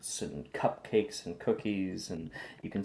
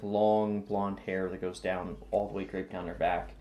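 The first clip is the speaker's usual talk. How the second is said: ordinary delivery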